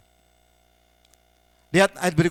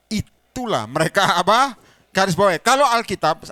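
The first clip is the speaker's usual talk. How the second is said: a loud, high preacher's push on these words; loud emphasis; 4 semitones higher, 7 dB louder than usual. Real, not acted. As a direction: hammer the point home to the congregation. (pengkhotbah menegaskan dengan suara keras dan tinggi)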